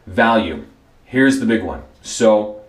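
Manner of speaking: ordinary talk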